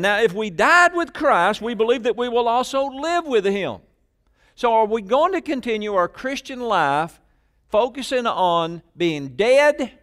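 high, loud, insistent sermon delivery; forceful preaching. Real, not acted. Preacher challenging the church to focus on life, forceful. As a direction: drive each point home at full force.

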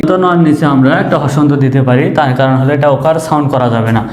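usual speaking voice

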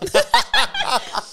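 risa aguda y nerviosa. Real, not acted. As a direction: se ríe de los nervios. Risa al recordar. (risa aguda al recordar)

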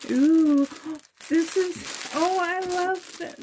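real delight at a gift; gift-opening excitement; delighted squeal. Real, not acted. Delighted unboxing a present.

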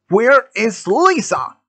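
slow, high demand. A narrator's reading. Gunman points his weapon at Andy, demanding. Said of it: "he demanded".

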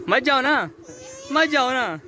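high, mock-pleading whine with falling ends; playful pleading. Begging little Vyanu not to go, high playful pleading.

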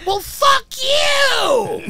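high, slow, loud rocker yell; mock rock-star shout. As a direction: bellow it like a hair-metal singer. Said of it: "you're like".

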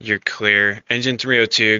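normal talking voice

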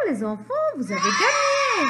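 triumphant cheer. Pretend. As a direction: celebrate the children's win. Teacher announces children have won. (annonce de victoire enjouée, voix aiguë)

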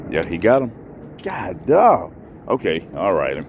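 usual speaking voice

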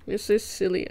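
normal talking voice

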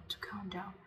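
breathy self-soothing whisper; whispered to herself. Shown as a demonstration. To herself during a panic, whispering calm words.

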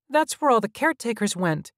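put-on very high child's voice; worried child hologram. A narrator's reading. Child hologram warning visitors against leaving, high and urgent. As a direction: plead high, like a little boy.

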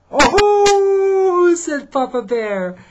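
very high, loud startled oh; surprised oh. Acted. Papa Bear sees the cub in the mirror, high and loud.

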